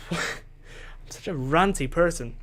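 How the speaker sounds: usual speaking voice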